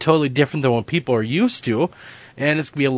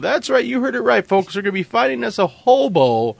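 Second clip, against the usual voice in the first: TV host voice; 8 semitones higher than usual. Pretend. Mock host addressing folks.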